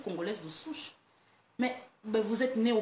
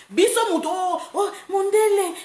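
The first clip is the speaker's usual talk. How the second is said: high loud mimicking children; mock kids calling; 11 semitones higher, 7 dB louder than usual. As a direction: call out like excited children.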